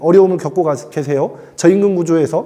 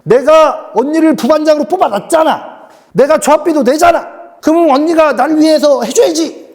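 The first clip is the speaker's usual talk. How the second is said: higher and louder, a demanding member insisting on her due; entitled union member; 9 semitones higher, 4 dB louder than usual. Acted. Member demanding the vice-leader work for her, high and insistent. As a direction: whine like a member who thinks her dues buy service.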